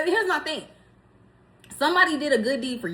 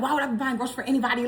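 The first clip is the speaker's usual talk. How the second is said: imitating husband; 4 dB quieter, much faster than usual. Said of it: "he was like".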